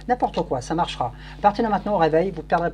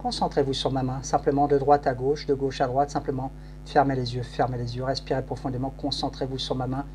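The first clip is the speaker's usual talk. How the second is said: hypnotic induction; 3 semitones lower than usual. Acted. Hypnotist leads trance induction.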